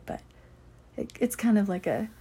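ordinary delivery

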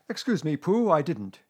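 high, loud protest; indignant denial. A narrator's reading. Owl denying a sneeze to Pooh, indignant.

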